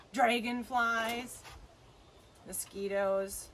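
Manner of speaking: ordinary delivery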